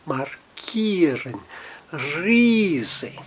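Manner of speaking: markieren and riesen with a drawn-out "ee"; long I. Shown as a demonstration. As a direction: softly stretch the IE in markieren and riesen as "ee". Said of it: "um I um pouco mais alongado".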